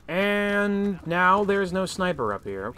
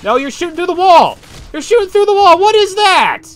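yelling; 13 dB louder than usual. Real, not acted. Yelling at enemy shooting through wall.

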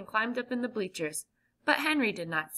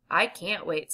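plain reading voice

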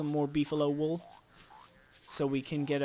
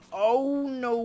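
sudden loud higher oh no; surprised oh no; 7 semitones higher, 5 dB louder than usual. Real, not acted. Gamer startled as the game throws him out. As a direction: jolt of alarm, loud.

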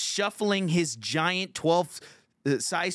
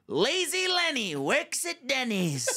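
high, drawn-out mocking heckle; Donald Trump; 11 semitones higher, slower than usual. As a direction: stretch it into a slow taunting nickname chant. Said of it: "I start Trump heckling him".

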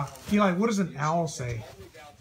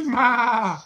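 goat bleat imitation; 5 semitones higher, 5 dB louder than usual. Shown as a demonstration. Answering goat sound.

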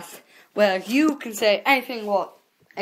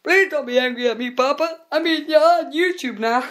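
higher, louder mock plea to Papa; pleading to dad; 6 semitones higher, 3 dB louder than usual. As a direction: beg dad sweetly, a touch higher. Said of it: "and I was like".

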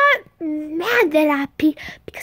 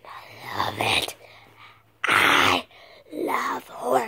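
low hushed whisper, slower; spooky whisper; 5 semitones lower, 5 dB quieter, slower than usual. Pretend. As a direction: whisper it like a creepy secret. Whispered love for horror.

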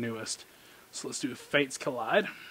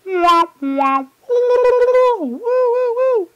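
high, loud sad-trombone wails; mock wah wah; 12 dB louder, much slower than usual. Opening a pack, mocking the pull with a wah-wah. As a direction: wail a mock fail fanfare.

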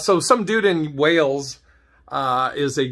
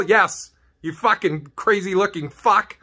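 usual speaking voice

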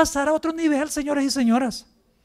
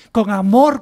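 ordinary talk, nothing marked

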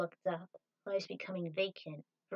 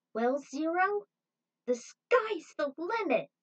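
excited exclamation; 9 semitones higher, 7 dB louder, slower than usual. Acted. Trudy's joyful answer.

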